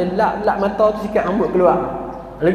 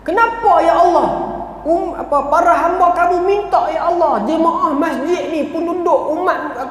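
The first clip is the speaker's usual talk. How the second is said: rough blunt prayer; 6 semitones higher than usual. Retold or imitated. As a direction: act out an old man scolding God for the rain. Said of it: "Tapi cara dia kasar". (doa kasar, nada tinggi dan lantang seolah menuntut Tuhan)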